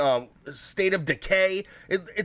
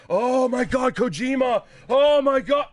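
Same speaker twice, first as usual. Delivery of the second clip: sarcastic gasp of fake awe, louder and higher; mock fan excitement; 5 semitones higher, 4 dB louder, slower than usual. Mocking the Kojima hype to viewers, with a hammed-up excited cry. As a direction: ham up fanboy amazement at the Kojima reveal.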